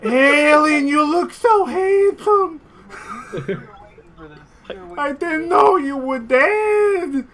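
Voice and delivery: put-on very high, loud, slow old woman; old aunt thinks the turtle is her late husband; doting old widow. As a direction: gush over a long-lost husband. Aunt flirts with 'husband'.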